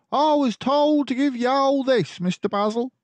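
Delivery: a nervous common mouse begging pardon; nervous messenger mouse. Acted. Messenger hands over envelope.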